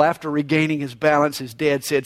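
usual speaking voice

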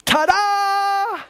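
very high, sustained reveal; a child's big ta-da moment; triumphant little girl. A narrator's reading. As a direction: belt out a grand high flourish. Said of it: "threw out her arms as wide as she could and she said".

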